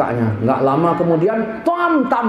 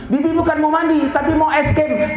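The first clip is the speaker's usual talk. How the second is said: put-on high little-kid whine; mock childish objection, high pitch; protesting child; 9 semitones higher than usual. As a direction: imitate a child refusing a bath. Child protests against bath.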